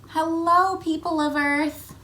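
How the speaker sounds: high, bright greeting from orbit; greeting from space. Acted. Reader greets Earth as the astronaut. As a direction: call hello down to Earth.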